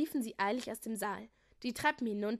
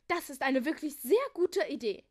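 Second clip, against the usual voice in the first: higher, louder praise; calling out approvingly; 4 semitones higher, 7 dB louder than usual. A narrator's reading. Old king praising Julia's idea, loud approving call. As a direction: exclaim it with emphatic approval. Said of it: "rief der alte König".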